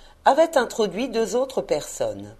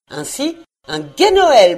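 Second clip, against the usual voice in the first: louder, higher, slow festive greeting; hearty wish; 5 semitones higher, 8 dB louder than usual. A narrator's reading. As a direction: wish it heartily, taking your time. Neveu souhaite un joyeux Noël. (vœu chaleureux, lent et sonore)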